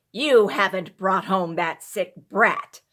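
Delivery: loud, shocked and angry outburst; wife scolds husband over the sick child, angry and astonished; angry astonishment. A narrator's reading. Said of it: "Anger and astonishment were in the tones".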